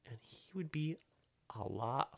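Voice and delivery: hushed dramatic whisper. Real, not acted. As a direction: drop to a whisper for suspense. Dramatic build-up before the reveal.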